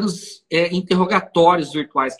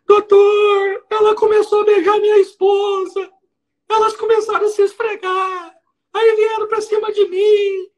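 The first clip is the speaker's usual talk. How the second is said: much higher, wailing sobbing plea, hammed up; mock weeping defendant; 12 semitones higher than usual. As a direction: imitate the defendant crying out to the judge. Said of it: "E chorando".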